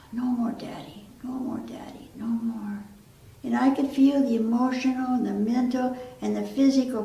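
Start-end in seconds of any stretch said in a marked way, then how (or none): 0.1-2.8 put-on soft, understated frightened child; hushed retelling of a child's cries; terrified child's plea. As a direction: plead quietly, lightly voicing a scared child. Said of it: "cries of a terrified child".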